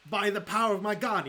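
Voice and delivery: loud, high heroic proclamation; roleplay battle cry. Acted. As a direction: proclaim it like a holy warrior smiting. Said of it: "this could be a roleplay opportunity".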